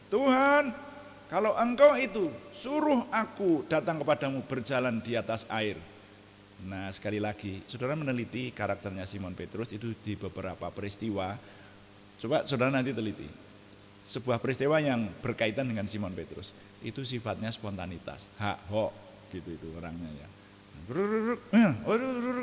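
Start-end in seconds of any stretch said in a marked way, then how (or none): 0.1-5.7 high, loud eager call; impulsive Peter. Retold or imitated. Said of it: "langsung berkata". 20.8-22.4 impulsive rumble. Retold or imitated. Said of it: "tanpa pikir gitu".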